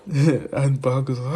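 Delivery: normal talking voice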